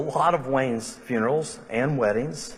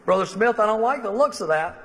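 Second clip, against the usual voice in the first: a nurse, pitched up and a bit louder, tutting; disapproving nurse; 8 semitones higher, 3 dB louder than usual. Retold or imitated. A nurse sizing up the catheter, mildly disapproving. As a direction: frown at it like a fussy nurse.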